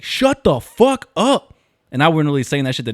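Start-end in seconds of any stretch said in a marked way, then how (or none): 0.0-1.5 slow, high-pitched mock yell; retold yell. Softball player yelling at nobody in particular, retold yell. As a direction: stretch each word into a hammed-up holler. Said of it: "I literally was just like".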